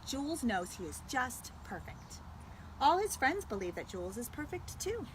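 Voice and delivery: ordinary talk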